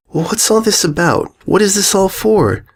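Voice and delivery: put-on high, young and worried; a small filly, anxious and confused. Sweetie voiced, high.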